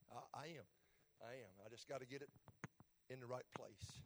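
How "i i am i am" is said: ordinary delivery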